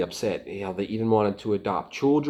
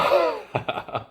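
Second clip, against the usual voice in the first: breathy laugh; 8 semitones higher than usual. Real, not acted. Laughing at divorce reason.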